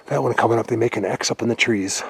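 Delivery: usual speaking voice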